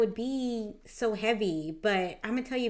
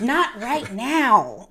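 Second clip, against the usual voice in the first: stretched out, louder, half-laughing; mother too tired for a bedtime talk; slow exasperated brush-off; 3 semitones higher, 9 dB louder, much slower than usual. Retold or imitated. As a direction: drag each word out, fed up. Repeated with emphasis.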